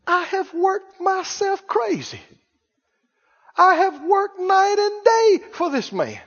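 near tears, pour out the unfairness; aggrieved complaint. Acted. Older son fuming outside the party, high and near tears.

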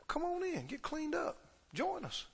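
high, very soft pleading invitation; gentle coaxing. Acted. Father inviting his angry son inside, gently coaxing.

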